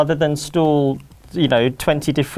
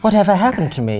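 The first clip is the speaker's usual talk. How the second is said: lightly put-on posh accent, higher pitch; non-Cockney accent; 6 semitones higher than usual. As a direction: lose the Cockney for a mock-genteel accent. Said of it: "he loses his Cockney".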